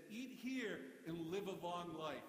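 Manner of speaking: slow, deliberate slogan read; billboard slogan. Shown as a demonstration. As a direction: read it like a sign, slowly. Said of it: "and it said".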